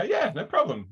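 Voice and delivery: put-on high, bright little-kid yes; cheerful toddler. Toddler answering his dad, in a high eager kid voice. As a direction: chirp it like an eager three-year-old. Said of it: "Then he'll go".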